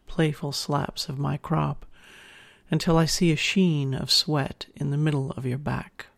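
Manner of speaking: ordinary delivery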